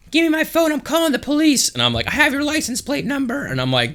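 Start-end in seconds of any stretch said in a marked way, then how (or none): 0.1-1.7 mock screaming woman. Girlfriend demanding her phone back, mock high-pitched scream. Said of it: "screaming at me". 2.1-3.4 very high, held-back mock shriek. Quarrel beside the car over police, high mock threat.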